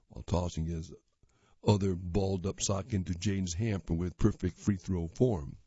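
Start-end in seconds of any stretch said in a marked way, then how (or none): none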